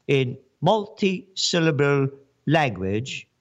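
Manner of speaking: mock official talk. Shown as a demonstration. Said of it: "they talk like this".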